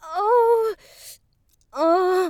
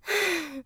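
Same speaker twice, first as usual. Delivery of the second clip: satisfied sigh; 5 dB quieter than usual. Acted. Afterglow sigh.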